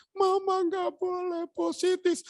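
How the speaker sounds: put-on very high, drawn-out child wail; mock child's protest to mother; imitated youngest child. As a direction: plead like a little child, high and slow. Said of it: "Anak saya yang paling kecil".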